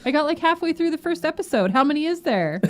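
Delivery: high, bright daughter impression; teen daughter voice. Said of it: "she was like".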